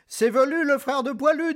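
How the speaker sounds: high lively goat voice; middle goat. Acted. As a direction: chirp it like a young goat. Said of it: "dit le moyen bouc".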